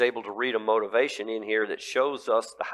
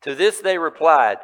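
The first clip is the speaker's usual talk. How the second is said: ordinary talk, nothing marked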